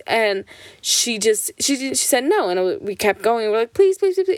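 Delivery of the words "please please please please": put-on high, rapid-repeat kid pleading; begging child. Sisters who kept going after mom's no, pleading. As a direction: keep begging, pitched up like a child. Kids keep begging mom.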